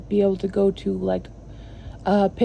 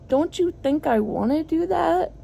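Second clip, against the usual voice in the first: higher, mock-defensive retort; retold exasperation; 8 semitones higher than usual. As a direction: replay the frustrated comeback. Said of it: "I get so triggered because I'm like".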